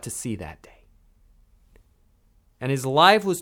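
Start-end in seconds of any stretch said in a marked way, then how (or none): 0.1-0.7 softer, whispered ending. Real, not acted. Preacher telling of Bartimaeus' healing in a whisper. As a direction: let it land in a hush.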